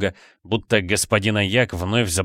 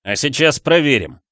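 higher, heated threat; mild angry yell; 6 semitones higher, 4 dB louder than usual. A narrator's reading. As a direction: take up the challenge angrily. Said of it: "не своим голосом заорал Флинн". (сердитый выкрик вполсилы)

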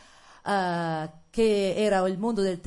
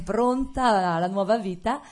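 ordinary talk

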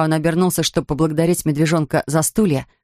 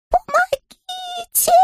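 high, slow muffled cry for help; strangled voice; much slower than usual. Acted. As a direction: cry out as if squashed. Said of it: "завопил придушенный голос".

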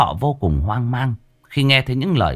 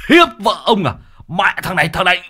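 high, furious accusation; outraged husband; 8 semitones higher, 4 dB louder than usual. A narrator's reading. Husband to man atop his wife, angry shouting. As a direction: raise the pitch and curse him in outrage.

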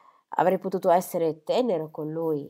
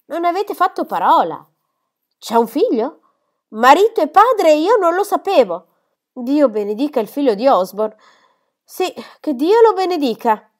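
higher and louder, bursting with emotion; overwhelmed joy; 8 semitones higher, 9 dB louder than usual. Acted. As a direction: cry out the news of a grandson. Squire learns of a grandson. (padre che esulta commosso per il nipote)

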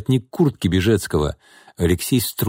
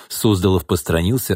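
usual speaking voice

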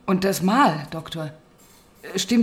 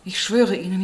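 usual speaking voice

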